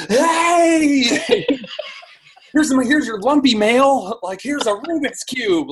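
hammed-up overeager stranger shoving gifts; mock pushy pitch. Mocking people who send lumpy mail to influencers, high laughing pitch.

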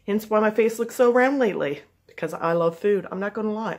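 ordinary delivery

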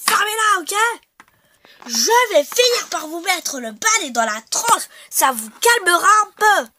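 put-on shouting old lady. Doyenne shouting at the insolent children with a broom. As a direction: bellow at the insolent kids like a cranky old lady.

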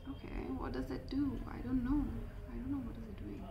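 soft talking to herself; puzzled undertone. Real, not acted. Trying out the lip balm alone, quietly puzzled.

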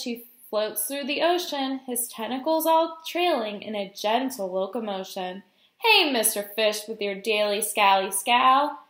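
bouncy, rhyming storybook delivery; reading to children. A narrator's reading. Guest reader voicing jellyfish and glum fish, animated storytime read. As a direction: swing the pitch through each rhymed exchange.

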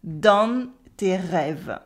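very slow southern French "Dans tes rêves", N sounded; deliberate southern French demo, "dan-n tay rev-uh"; southern French accent. Shown as a demonstration. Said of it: "the final silent E".

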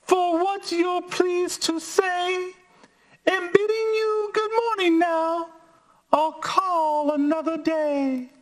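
high squeaky fly voice; tiny high-pitched polite voice. Acted. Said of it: "she said".

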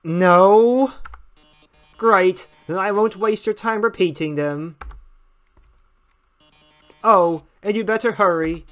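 brisk, teasing host patter; smug game-show host. Acted. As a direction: rush the rules with a grin. Mettaton host lines.

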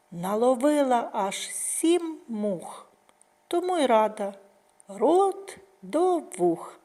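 lively nursery rhyme, last words drawn out; children's rhyme. Acted. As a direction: recite it bouncily, stretching the last line slow.

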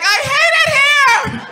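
put-on high, slow, loud teen whine; the dad mimics his daughter's whine; whiny kid voice. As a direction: whine like a sulking child. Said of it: "I'm like".

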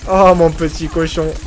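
teasing an enemy like a pet; taunting. Real, not acted. Streamer taunting an enemy player, mock sweet.

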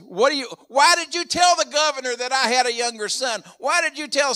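upset father. Retold or imitated. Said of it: "and Jacob is upset".

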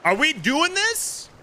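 high, slow rallying question; hyped up. Real, not acted. Streamer rallying the crew before the fight, high and hyped.

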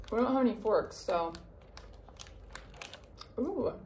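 talking while eating; mouth full, chewing. Real, not acted. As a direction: hand near mouth, chewing. Seen 0: Puts food in mouth at -1, chews while talking; hand covers mouth later.

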